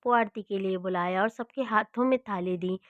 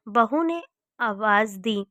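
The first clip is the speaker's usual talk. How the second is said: ordinary delivery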